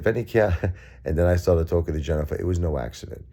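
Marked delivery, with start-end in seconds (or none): 0.0-0.7 imitating Joey. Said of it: "he said".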